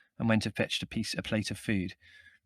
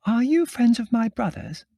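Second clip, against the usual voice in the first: very high, loud, fluty woman's voice; fluting call; 14 semitones higher, 8 dB louder than usual. A narrator's reading. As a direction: flute it out to people below. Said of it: "Margot fluted out of the window".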